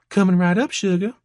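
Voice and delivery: high, perky Georgia waitress; Georgia accent. A narrator's reading. Waitress taking a customer's order, perky Georgia accent. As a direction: chirp it back in a Georgia accent. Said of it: "an accent that was distinctly from Georgia".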